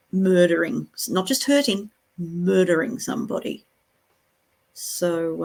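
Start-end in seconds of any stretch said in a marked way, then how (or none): none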